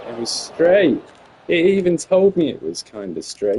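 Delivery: loud, high outraged disbelief; indignant protest. Real, not acted. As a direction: protest loudly that it was straight. Putt misses, he protests loudly.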